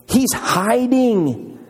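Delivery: drawn-out emphasis. Real, not acted. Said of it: "stretched for emphasis".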